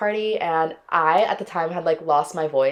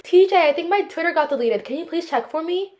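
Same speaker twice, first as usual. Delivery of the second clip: put-on high, sing-song ex-girlfriend voice; sweetly needy, pitched way up; mock girly ex; 10 semitones higher than usual. Ex-girlfriend asking him to check her Twitter, high and cutesy. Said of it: "She's like".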